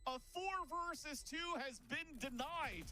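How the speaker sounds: high, rising hype clutch call; esports caster. Acted. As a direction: build the call to a big finish. Climactic clutch call in the round.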